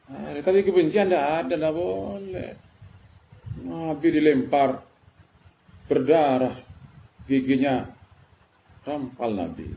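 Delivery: ordinary delivery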